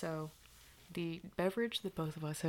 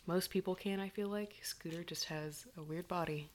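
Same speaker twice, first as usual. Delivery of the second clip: soft unvoiced whisper; ASMR whisper; 5 dB quieter than usual. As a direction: whisper close to the mic.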